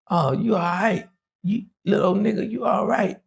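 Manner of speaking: high, pleased oh you all right; a boss won over by honesty; high, amused praise; impressed approval. Retold or imitated.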